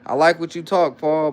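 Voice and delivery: usual speaking voice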